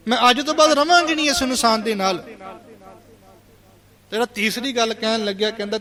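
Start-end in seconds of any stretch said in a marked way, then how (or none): 0.1-2.2 high, loud acted protest; heated, higher-pitched declaration; adamant refusal. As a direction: play a daughter flatly refusing, voice raised. Acted daughter refuses to stay.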